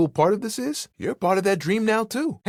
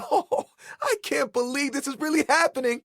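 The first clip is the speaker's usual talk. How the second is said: happy tears; 8 semitones higher than usual. Acted. Overwhelmed by his new look.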